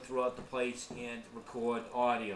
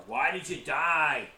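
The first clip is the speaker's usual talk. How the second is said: slow, louder, blunt ghost-hunter question; mock rude question; 6 dB louder, slower than usual. Shown as a demonstration. Mocking bad investigators asking spirits, slow and blunt. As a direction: ham up a tactless question.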